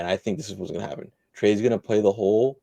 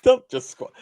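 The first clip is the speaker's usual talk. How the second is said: laughing; 6 dB louder than usual. Real, not acted. Laughing at co-host's phrase.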